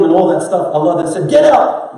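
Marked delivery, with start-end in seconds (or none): 1.3-2.0 sharp, high, loud cry of get out; angry command. Pretend. God casting Iblis out, a loud angry shout. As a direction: bark the order, furious.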